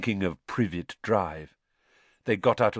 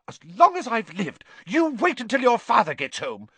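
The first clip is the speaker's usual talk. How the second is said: much higher, loud furious scolding; raging mother; 6 dB louder than usual. A narrator's reading. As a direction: tear into the boys in fury. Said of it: "her rage broke over them".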